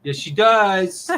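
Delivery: loud, slow, drawn-out teasing; laughing insistence. Real, not acted. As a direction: stretch it out loudly through a laugh. Teasing contradiction after her denial.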